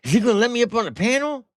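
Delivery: high, fast mocking squeal of eagerness; mock excited. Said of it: "you getting excited".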